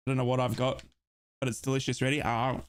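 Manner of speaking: talking through a mouthful of cookie; chewing while speaking; messy loud eating. Real, not acted. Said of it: "Don't talk with your mouth full".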